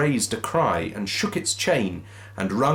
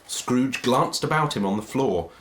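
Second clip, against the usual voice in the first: ordinary delivery, nothing marked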